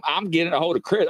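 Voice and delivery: ordinary talk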